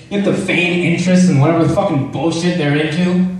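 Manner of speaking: ordinary talk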